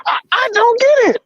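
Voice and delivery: very high-pitched distraught wail; overwrought, emotional. Real, not acted. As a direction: wail in disbelief, voice pushed way up high. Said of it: "I'm just so emotional right now".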